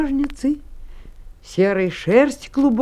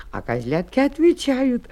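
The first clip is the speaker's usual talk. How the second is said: plain reading voice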